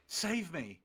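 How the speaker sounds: normal talking voice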